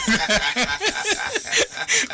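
a longer real laugh; amused laugh trailing off; laughter. Real, not acted. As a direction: keep laughing at the story. Laughs at the father story.

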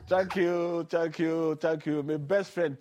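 loud, bright-voiced repeated thanks; excited thanks. Real, not acted. Host gratefully thanks the guest after the clip, loud and excited. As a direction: pour out thanks to the caller with gusto.

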